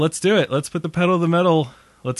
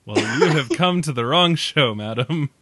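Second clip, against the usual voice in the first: ordinary talk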